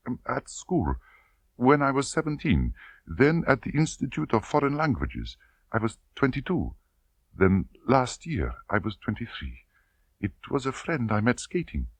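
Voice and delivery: put-on timid girl admitting her lovers; hesitant young woman. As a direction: murmur the confession reluctantly. Tatiana confesses.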